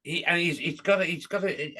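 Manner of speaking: ordinary delivery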